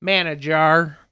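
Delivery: loud, drawn-out Southern "Manager"; mock Southern "Manager", ending like "jar"; Southern accent. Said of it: "Actually pronounced Manahar".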